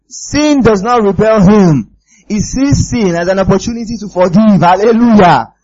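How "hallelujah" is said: ordinary talk, nothing marked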